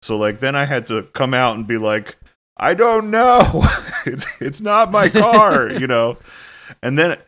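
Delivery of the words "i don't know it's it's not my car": high, slow, loud mock shout with a laugh; exasperated mock yell, high and drawn out; retold shout. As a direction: yell it helplessly across the street.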